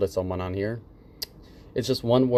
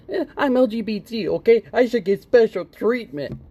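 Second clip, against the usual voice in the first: higher, louder, hammed-up entitled demand; mock entitled whine; 8 semitones higher, 3 dB louder than usual. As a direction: whine like someone owed special treatment. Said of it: "like he said".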